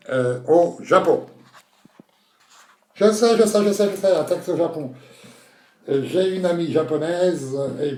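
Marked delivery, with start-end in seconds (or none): 3.0-4.9 high, rapid excited blurt; eager contestant. Acted. Contestant claiming the taxi question, excited. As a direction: burst in as if first to the buzzer.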